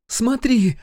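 high, loud startled cry; gasped exclamation. A narrator's reading. Кейла spots something by the barracks, gasping. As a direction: cry out on a sudden sighting. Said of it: "ахнула Кейла".

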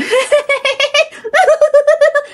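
long, very high staccato giggle; put-on possessed-child laugh; imitated eerie giggle. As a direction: giggle mindlessly while staring at everyone. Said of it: "Mà cứ nhìn mọi người".

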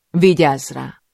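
loud, hard command; hard, stern. Acted. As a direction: order it with an iron voice. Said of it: "A hangom sohasem volt olyan kemény". (kemény, szigorú parancs)

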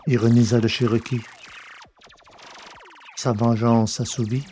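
usual speaking voice

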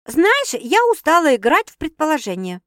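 complain, fed up with guessing; grumbling. A narrator's reading. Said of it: "проворчала сестра". (ворчливо, раздражённо)

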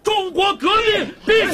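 high, defiant rallying cry; slogan shout. Acted. Prisoner calling revolutionary slogans to the crowd, defiant shout.

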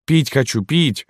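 put-on high-pitched child's whine; whining children. A narrator's reading. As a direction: plead in a high little child's voice. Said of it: "Дети заныли наперебой". (ноющий детский голос)

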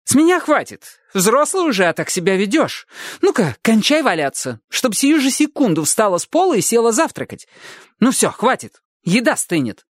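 high, sharp scolding; barking. A narrator's reading. Older sister scolding the girl on the floor, barking. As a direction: snap her out of it. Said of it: "рявкнула Эдди".

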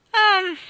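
high, loud "ehm"; loud hem. A narrator's reading. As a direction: hem sharply before answering. Nanny clears throat before answering.

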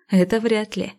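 ordinary delivery

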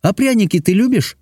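high-pitched princess asking; princess, a little calmer. A narrator's reading. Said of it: "немножко успокоилась и спросила".

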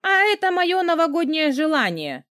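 high, defiant declaration. A narrator's reading. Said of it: "выпятила вперёд подбородок".

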